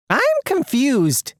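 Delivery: high, slow, puzzled voice; acted bewilderment; confused. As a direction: draw it out in high-pitched bafflement. Character lost by the explanation.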